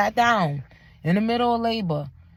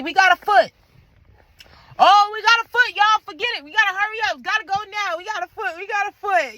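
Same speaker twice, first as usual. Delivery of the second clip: high-pitched, frantic urgency; imitating panicked hospital staff; mock emergency panic; 11 semitones higher, 4 dB louder than usual. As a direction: sound the alarm and rush everyone, acted out. Acts staff's emergency alarm at foot.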